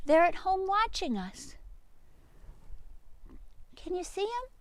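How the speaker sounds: high playful coaxing voice; calling the sheep. Real, not acted. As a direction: sweet-talk the sheep out.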